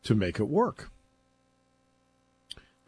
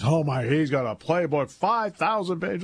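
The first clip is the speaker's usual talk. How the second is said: higher-pitched, slowing imitation of awed fans; sarcastic wide-eyed wonder; mock amazement; 6 semitones higher than usual. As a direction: gush in mock awe, stretching the big number. Mocks awe at playbook size.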